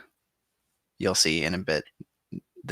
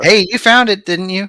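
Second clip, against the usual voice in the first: high, loud playful comeback; pitched-up teasing retort; mock defensiveness; 10 semitones higher, 7 dB louder than usual. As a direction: protest with hammed-up indignation. Mock defense in retold quarrel.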